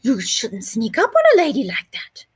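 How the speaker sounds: prim lady scolding. Acted. Cockroach scolding the lizard, prim and lifted. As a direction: put the sneaky lizard in his place.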